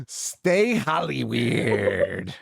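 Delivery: slow, drawn-out sign-off; drawn-out podcast farewell; sign-off catchphrase. Acted. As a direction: stretch out the show's farewell line. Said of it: "podcast sign-off catchphrase".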